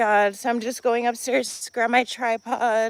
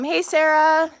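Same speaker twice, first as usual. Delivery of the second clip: ordinary delivery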